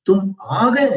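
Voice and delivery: "आ गए" swooping up high in disbelief; disbelieving surprise. Shown as a demonstration. Teacher acting someone not expecting a punctual arrival, disbelieving surprise. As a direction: question it incredulously, rising sharply on "आ गए". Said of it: "मैंने तुमसे आशा नहीं थी कि तुम time पर आ जाओगे".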